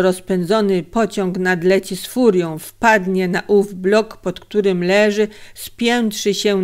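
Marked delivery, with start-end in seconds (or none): none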